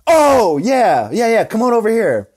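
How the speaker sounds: high, loud "oh" of the penny dropping; mock receptionist catching on, swooping high; sudden realisation. Receptionist finally gets the code, loud exaggerated realisation. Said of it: "she finally goes".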